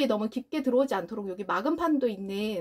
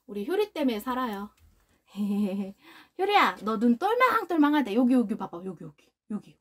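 usual speaking voice

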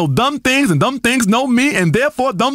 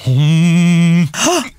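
deep hum; 8 semitones lower than usual. Acted. Guru hums on air.

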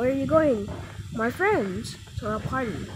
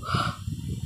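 gasp; 7 dB quieter than usual. Acted. Slapped by sister.

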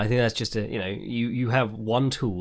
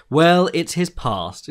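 slow, higher and louder mock shrug; dismissive mock quote; 6 semitones higher, 6 dB louder, much slower than usual. Mocking fans who shrug off Nazi tattoos, slow dismissive drawl. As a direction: drawl out the excuse like it's nothing.

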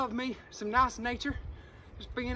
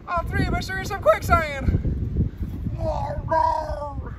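louder, higher panic; panicked; 3 semitones higher, 6 dB louder, slower than usual. Pretend. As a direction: shout in alarm while sinking. All stuck, panicking.

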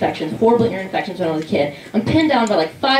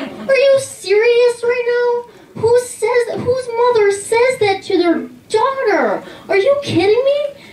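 much higher, slow, shrill-free mock outrage; outraged disbelief; 14 semitones higher, slower than usual. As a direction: squeal it up high in disbelief. Outraged at mom's comment.

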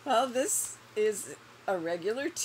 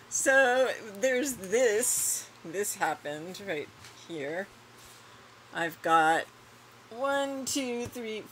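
ordinary talk

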